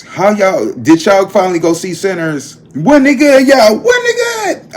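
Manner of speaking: loud, high, gushing hype; excited shout about the movie. Real, not acted. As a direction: build to a shouted high squeal. Excited asking viewers about movie.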